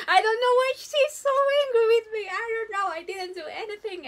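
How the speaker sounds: hammed-up child's complaint; whiny child. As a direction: whine like a scolded kid. Child self voiced, bangs cut.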